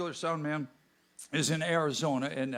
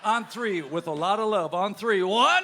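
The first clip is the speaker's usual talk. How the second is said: rising count, ending in a high shout; counting the crowd in; 5 semitones higher, 7 dB louder, slower than usual. Real, not acted.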